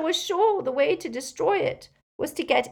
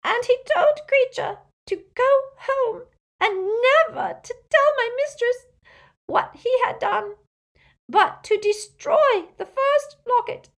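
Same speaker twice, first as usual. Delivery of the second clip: high, loud elf wail, sobbing hard; great rasping sobs; 10 dB louder than usual. A narrator's reading. Elf recalls his master dragged beneath the water, sobbing wail. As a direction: cry the words out, barely understandable. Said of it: "Creature's sobs came in great rasps now".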